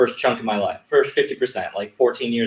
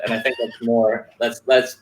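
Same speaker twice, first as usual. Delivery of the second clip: ordinary talk, nothing marked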